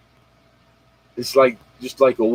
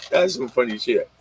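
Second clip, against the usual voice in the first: ordinary talk, nothing marked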